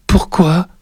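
put-on high, worried child's question; nervous small-boy voice; slightly quavering. A narrator's reading. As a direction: ask it as an uneasy little boy. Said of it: "la voix un peu vibrante".

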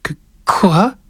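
put-on high child's stammer; startled little boy, voice a bit tremulous; slightly quavering. A narrator's reading. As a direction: stammer it like a worried small boy. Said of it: "la voix un peu vibrante".

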